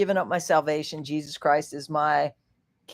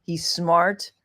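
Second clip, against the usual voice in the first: usual speaking voice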